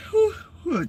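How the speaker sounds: high, breathy yawning sighs; real yawn from no sleep; big yawn. Real, not acted. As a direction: let out a long, tired yawn. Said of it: "just talking about sleeping, that happened".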